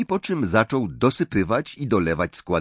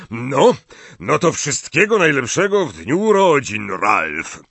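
hearty toast, louder; 3 semitones higher, 4 dB louder than usual. A narrator's reading. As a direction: raise the glass jovially, louder. Slughorn raises a jovial toast. (głośny, rubaszny toast)

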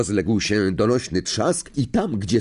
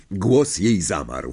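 ordinary delivery

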